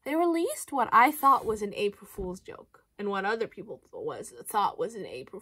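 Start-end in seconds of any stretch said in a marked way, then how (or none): none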